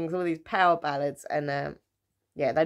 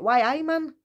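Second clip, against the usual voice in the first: attempted Newcastle accent, pitched up high; bad Newcastle accent; 9 semitones higher, 4 dB louder than usual. Shown as a demonstration. Showing viewers an accent she can't do, bad Newcastle accent. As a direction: have a poor go at Newcastle, high-voiced. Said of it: "I can't do the Newcastle accent".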